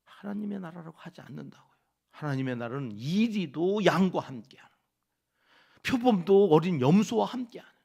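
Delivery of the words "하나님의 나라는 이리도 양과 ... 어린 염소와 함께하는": loud, fervent preaching; full-force sermon voice. Acted. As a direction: proclaim it to the congregation.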